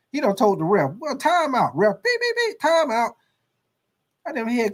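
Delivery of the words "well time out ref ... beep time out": frantic coach. Acted. Coach beeping for time out.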